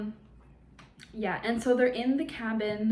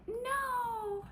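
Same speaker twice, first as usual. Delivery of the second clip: put-on very high, amateur Australian accent; 4 dB quieter than usual. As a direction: attempt a high-pitched Aussie wail. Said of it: "Australian accent from H two O".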